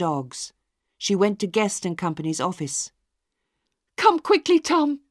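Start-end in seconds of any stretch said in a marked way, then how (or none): 4.0-5.0 high, breathless urgent call. A narrator's reading. Sister urging her brother to come, high and urgent. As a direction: hurry him to the dying father.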